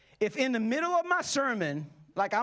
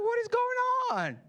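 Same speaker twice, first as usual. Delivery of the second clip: high-pitched comic disbelief; bewildered; 12 semitones higher than usual. Retold or imitated. Tourist cornered by timeshare sellers, mock bewildered.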